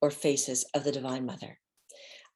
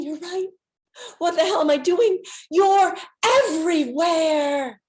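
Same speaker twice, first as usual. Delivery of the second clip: higher, louder, wondering; excited realization; 11 semitones higher, 8 dB louder than usual. Retold or imitated. Answering the Divine Mother, higher excited realization. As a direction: burst out with sudden realization. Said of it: "And I'm thinking".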